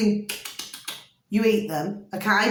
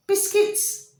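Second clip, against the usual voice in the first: a sudden much higher, otherworldly repeat; high echoed answer; 12 semitones higher than usual. Pretend. Spirit guards repeating the title in a high voice. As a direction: sing it out high like a voice from beyond.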